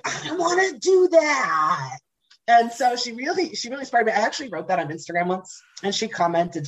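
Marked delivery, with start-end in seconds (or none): 0.0-2.0 slow, high, loud mock wail; hammed-up awed cry; mock awestruck wail. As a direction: whine it in comic awe. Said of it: "and I was like".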